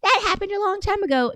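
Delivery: high, dismissive mock voice; mocking commenter. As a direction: mimic the know-it-all commenter. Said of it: "they were like".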